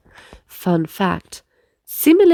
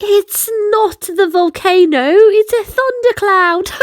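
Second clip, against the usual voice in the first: put-on relieved boy; 8 semitones higher, 5 dB louder than usual. Relieved realisation.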